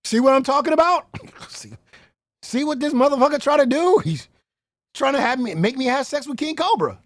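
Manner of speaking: loud, high-pitched outraged quote; indignant retelling. Retold or imitated. Retelling her reaction to the setup, loud indignant mimicry. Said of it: "She was like".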